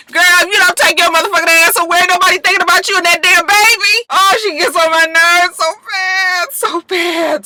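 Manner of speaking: high, loud fed-up rant; sassy exasperated scolding. Real, not acted. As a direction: tell her off in a shrill rage. Venting at a TV character.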